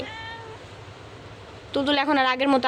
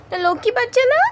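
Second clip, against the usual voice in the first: much higher, sing-song coo; high baby talk; 8 semitones higher than usual. Acted. Owner coos high at her lying cat.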